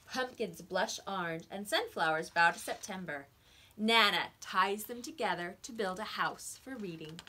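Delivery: put-on lively read-aloud for small children; expressive picture-book narration; story reading.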